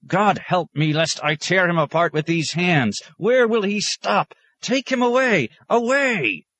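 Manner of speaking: high, loud, slow anguished cry building to the end; furious outburst. Acted. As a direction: rail at heaven in outrage. Librarian's outburst, hands raised.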